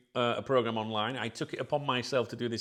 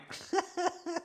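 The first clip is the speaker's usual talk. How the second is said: high short laugh at own joke; little teasing laugh; cheeky laugh. Real, not acted. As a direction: chuckle at the cheek of it. Laughs after super chat joke.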